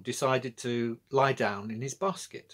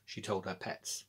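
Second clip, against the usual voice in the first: ordinary delivery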